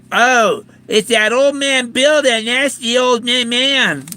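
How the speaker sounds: mocking imitation of someone griping about you. Mimicking a critic grumbling about the old man, mocking.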